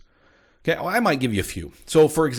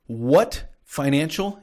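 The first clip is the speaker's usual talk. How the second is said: "financial" drawn out slowly after "What"; stress on financial; 3 semitones higher, much slower than usual. Real, not acted. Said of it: "I'm gonna stress the word financial".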